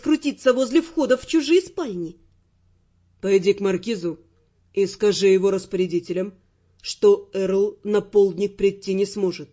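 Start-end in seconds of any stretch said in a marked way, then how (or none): 3.2-9.5 low, measured male command voice; Raymond, self-assured Earl. Acted. Raymond sending his squire to the Marquis with apologies, low and composed. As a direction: give orders low and evenly.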